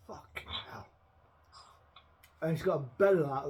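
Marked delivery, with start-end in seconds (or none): 0.1-0.9 under-the-breath whispered swear; muttered curse. Retold or imitated. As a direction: breathe the curse out, barely voiced. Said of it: "And you're like".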